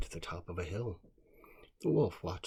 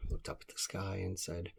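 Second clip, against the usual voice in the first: plain reading voice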